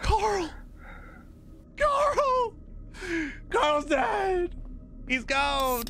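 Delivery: very high screaming cry for Carl; panicked screaming. Real, not acted. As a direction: shriek in real horror-game panic. Discovers friend killed in game.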